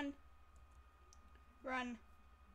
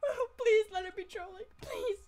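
higher, louder whiny begging; tearful mock plea; 7 semitones higher, 9 dB louder than usual. Hoping the threat is a troll, mock crying plea. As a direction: whimper the plea half-crying.

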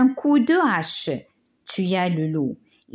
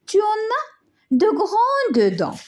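put-on scared little girl; 13 semitones higher than usual. A narrator's reading. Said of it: "s'écria le petit chaperon rouge".

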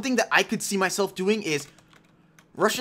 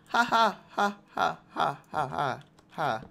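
spelled-out fake ha ha; mock fake laugh; 3 semitones lower, 3 dB quieter, slower than usual. Gamer mock laughing at his torches, fading.

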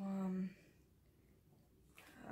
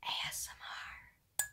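breathy unvoiced whisper, slow; ASMR whisper; 12 dB quieter, much slower than usual. Vlogger announcing ASMR, whispered slowly. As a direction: whisper each letter slowly into the mic. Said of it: "announces ASMR in whisper".

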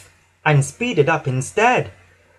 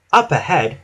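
plain reading voice

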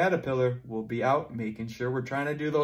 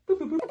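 button boops; 6 dB louder, much faster than usual. Pretend. Pressing pretend erase button.

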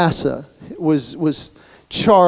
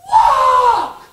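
frustrated scream; much slower than usual. Retold or imitated. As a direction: bellow it down the escalator, fed up. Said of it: "just screams at hundreds of people".

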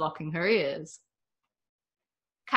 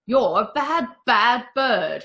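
louder, higher mock scolding of a pet; 5 semitones higher, 7 dB louder, slower than usual. A narrator's reading. The owner scolds his chicken, mock scolding. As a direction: tell off the bird fondly. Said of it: "he scolded".

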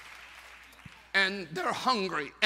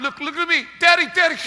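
excited eaglet calling; 6 semitones higher, 7 dB louder, much faster than usual. Acted. Eaglet flying, calls father.

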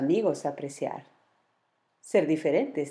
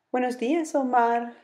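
high, friendly sleepy-bear voice; chirpy bear's hello; 8 semitones higher than usual. Acted. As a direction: greet him like a cheerful sleepy bear. Sleepy bear greets Omar.

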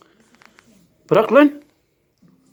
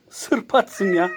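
usual speaking voice

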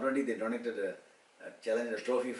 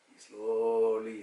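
usual speaking voice

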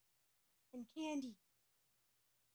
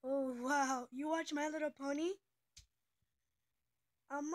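ordinary delivery

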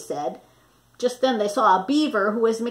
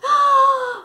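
loud high blowing puff; a big blow of breath; puffing blast; 7 dB louder than usual. Shown as a demonstration. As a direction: puff with all your might. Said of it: "here's your part".

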